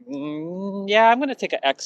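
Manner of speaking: long hesitant hum climbing high; mock reluctant dodge. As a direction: squirm out of it, pitch sliding way up. Imagined dodge of awkward question.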